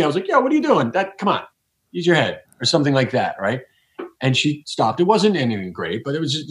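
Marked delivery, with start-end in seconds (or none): 0.3-2.4 impatient scolding, pitched well up; sharp scolding tone. Retold or imitated. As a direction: snap at a kid who's messing up. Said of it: "in a tone that feels normal to me".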